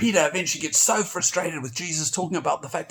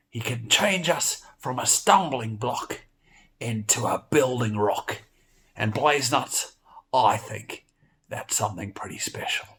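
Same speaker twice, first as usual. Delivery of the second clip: usual speaking voice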